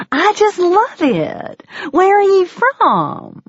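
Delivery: waitress asking the English guest where she's from; gushing waitress. Acted. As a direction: swoon over the accent in a high, slow waitress voice. Delighted waitress voiced by narrator.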